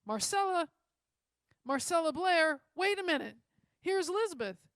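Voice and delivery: loud, high call across a crowd; girl calling loudly across the street. A narrator's reading. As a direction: shout her name to stop her. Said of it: "One of the girls called out".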